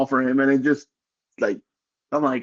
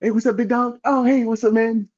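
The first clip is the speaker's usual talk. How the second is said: high-pitched impression of a coworker; imitating an upbeat friend's hello, pitched high; Angel's voice; 8 semitones higher than usual. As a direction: greet in a raised, imitated buddy voice. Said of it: "That's pretty much how that fucking goes".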